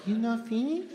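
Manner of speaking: put-on waitress asking in a Japanese accent; subtle Japanese accent. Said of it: "No, it was subtle".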